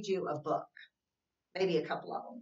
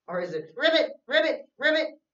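ribbit croaked higher and louder, playful; 9 semitones higher, 10 dB louder than usual. Pretend. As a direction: show the kids the frog's sound. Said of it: "what sound a frog makes".

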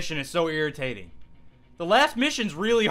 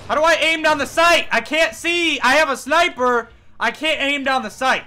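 frustrated yelling; 8 semitones higher, 5 dB louder than usual. Real, not acted. Frustrated at the game.

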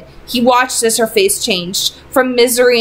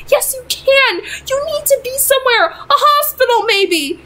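frantic mother. Acted.

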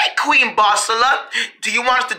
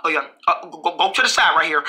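usual speaking voice

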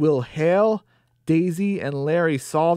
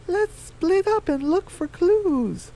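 very high, slow chicken voice; squeaky-high storybook hen asking the group to split up; high Daisy voice; slower than usual. Acted.

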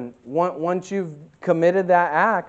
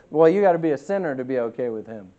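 normal talking voice

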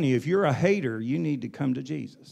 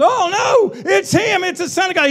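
much higher, shouted panicked cry; terrified demon cry; 11 dB louder than usual. Retold or imitated. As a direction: shriek like a cornered demon. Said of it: "they wanted to cry out".

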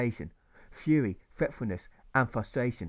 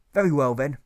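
stern teacher; 2 semitones higher, 9 dB louder than usual. Acted.

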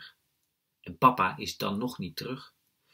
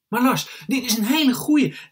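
put-on high, loud small-boy protest; protesting little brother; 12 dB louder than usual. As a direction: plead loudly in a child's high voice.

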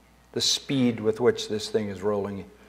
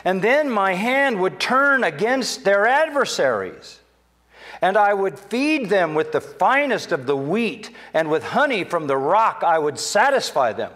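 higher, slow, rising pulpit voice; higher, slow promise from the pulpit; preaching God's promise; 8 semitones higher than usual. Acted. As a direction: proclaim God's promise with lift.

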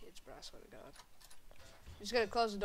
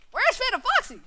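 high, loud call; 13 dB louder than usual. Acted. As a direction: call out across the room. Calling out loudly, high.

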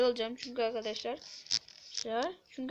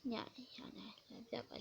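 hushed whisper; 15 dB quieter than usual. Real, not acted. Sudden hushed discovery.

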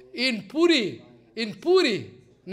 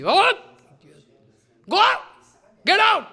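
much higher, loud shouts; high loud mock scolding; mock angry shouting; 11 semitones higher, 9 dB louder than usual. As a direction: yell them out. Scolding Krishna in the story.

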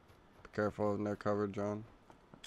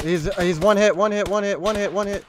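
high, loud excited shouting; excited callout; 10 semitones higher, 11 dB louder than usual. Real, not acted. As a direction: yell it out, urging them on. Enemy nearly down, urging.

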